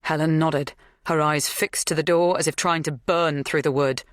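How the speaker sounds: ordinary delivery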